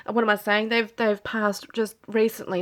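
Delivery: ordinary talk, nothing marked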